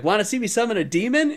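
high, fast, innocently eager; bubbly airhead with a nasty plan; ditzy offer. Retold or imitated. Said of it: "acting super ditzy".

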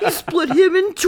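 high, loud mock-epic cry; hammed-up anime battle line; mock dramatic exclamation. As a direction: exclaim it high with theatrical awe.

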